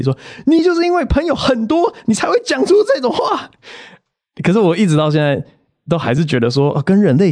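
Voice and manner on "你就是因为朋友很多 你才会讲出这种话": high-pitched, scolding mock sister; angry sister. Imitating his older sister scolding him, high and irritated. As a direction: snap back at the brother, pitched way up. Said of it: "我姐就非常生气".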